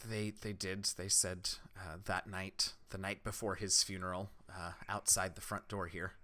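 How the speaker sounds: soft, low, hesitant villager; subdued, low-key character voice; grieving villager. Acted. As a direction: answer quietly and haltingly about a painful memory.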